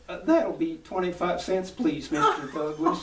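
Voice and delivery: high, slow character salesman voice; high-pitched huckster winding up his sales bit; Mister Haney. Acted. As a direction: ask for payment in a loud, stretched-out high voice. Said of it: "And then when I conclude, I go".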